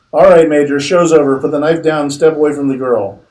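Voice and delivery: loud barked order to drop the knife; captain shouting at the major; commanding shout. Acted. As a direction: shout him down as you charge in.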